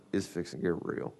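low, hushed aside to himself; gleeful aside. Retold or imitated. Onlooker to himself as trouble brews in the checkout line, hushed aside. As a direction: drop it low and quiet. Aside while hiding a grin.

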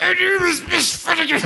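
high-pitched mock-unhinged shriek; crazed teacher outburst. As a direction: impersonate a teacher suddenly losing it. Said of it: "she'd all look really crazy".

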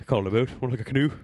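put-on quick Canadian accent line. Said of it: "I love the Canadian accent".

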